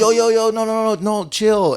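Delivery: high-pitched mock panic; alarmed pleading. Friends trying to calm an armed man, mock alarmed pleading. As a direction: talk down an attacker, imitated.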